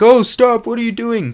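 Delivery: high, loud cry of protest; alarmed shout. Retold or imitated. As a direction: shout in alarm to stop someone. Acting out man stumbling on shootings.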